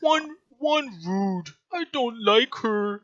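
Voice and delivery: high, slow, whiny simple creature; mock crying creature. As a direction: complain like a tearful dim-witted brute. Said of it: "like, crying".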